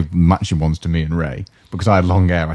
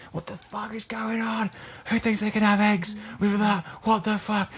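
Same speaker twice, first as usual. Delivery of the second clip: slow, strained yell as if heard from afar; imitated angry shouting; 11 dB quieter, slower than usual. Cook Jane raging at an egg order, imitated distant shouting. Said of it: "you just hear Jane shouting".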